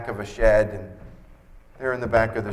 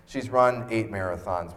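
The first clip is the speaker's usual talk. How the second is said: normal talking voice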